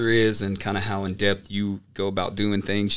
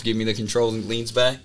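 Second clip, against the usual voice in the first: ordinary talk, nothing marked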